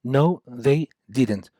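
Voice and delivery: Yes, they did and No, they didn't said very slowly; slow model answers. Shown as a demonstration. Teacher drilling short answers slowly for students. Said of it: "Did they buy a new house?".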